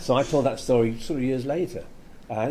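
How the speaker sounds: ordinary talk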